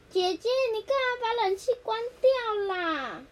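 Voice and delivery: high, loud whine; whiny kid. Acted. Little sibling whining at big sister about the air conditioner.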